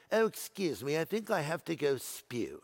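softly put-on British accent; mock British accent. Preacher playing someone feeling nauseated, mock British accent. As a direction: politely excuse yourself in a playful British accent. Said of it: "I don't know why you'd have a British accent".

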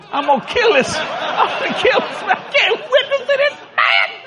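frantic high wail building to a scream; enraged protest. Acted. Believer arguing with the Lord, enraged scream.

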